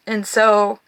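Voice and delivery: ordinary talk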